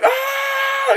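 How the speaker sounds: high ah cry; excited scream. Retold or imitated. First Avengers moment, a high excited cry. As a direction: yell in awe. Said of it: "where you're like".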